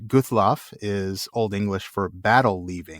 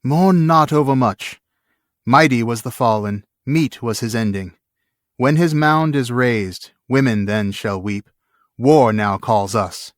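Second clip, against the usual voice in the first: louder, slow, firm words over grief; grieving commander; 5 dB louder than usual. Acted. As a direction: hold back tears and rally the men. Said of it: "Yet he himself wept as he spoke".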